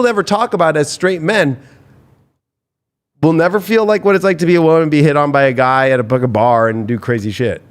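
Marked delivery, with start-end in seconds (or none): none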